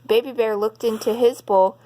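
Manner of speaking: usual speaking voice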